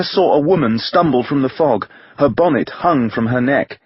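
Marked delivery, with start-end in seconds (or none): none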